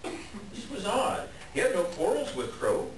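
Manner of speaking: usual speaking voice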